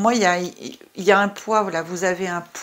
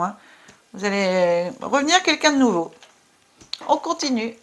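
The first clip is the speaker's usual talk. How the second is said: ordinary talk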